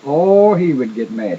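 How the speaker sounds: normal talking voice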